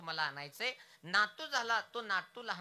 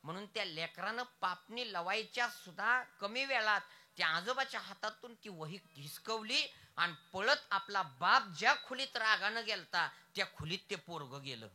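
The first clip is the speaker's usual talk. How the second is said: ordinary talk, nothing marked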